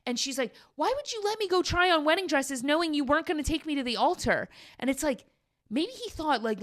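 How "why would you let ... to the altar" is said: high-pitched imitation of an aggrieved bride; upset complaint. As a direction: plead in a mock high voice, wronged.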